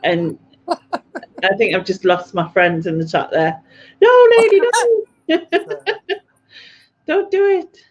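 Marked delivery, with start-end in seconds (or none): 4.0-7.8 high, loud mock plea; mock pleading. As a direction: beg her not to, hammed up.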